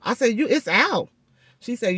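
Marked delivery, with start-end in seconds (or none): 0.4-1.1 high-pitched retold astonishment; amazed disbelief. Patient learning the tooth is out, amazed disbelief. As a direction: check it, hardly believing the news.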